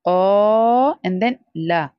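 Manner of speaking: क़ा drawn long, then a short ला; stretched qaa. Shown as a demonstration. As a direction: draw out क़ा, keep ला short. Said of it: "So we have to stretch first part". (क़ा लंबा, ला छोटा)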